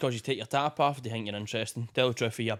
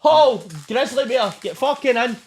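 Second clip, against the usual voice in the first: high, loud delighted cheer; excited cheer; 8 semitones higher, 6 dB louder, slower than usual. Real, not acted. Cheering at the sweets gift, high and loud. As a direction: whoop it out like a win.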